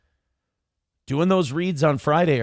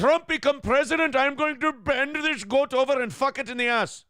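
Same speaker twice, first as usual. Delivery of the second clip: made-up character voice; 9 semitones higher than usual. Retold or imitated. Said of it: "Is that okay to do now?".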